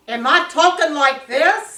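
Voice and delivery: loud, sharp demo of a snappy tone; harsh loud tone. Shown as a demonstration. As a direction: show how not to talk to someone. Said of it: "my tone of voice when I'm talking".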